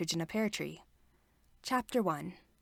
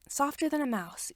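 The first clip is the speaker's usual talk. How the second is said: ordinary delivery, nothing marked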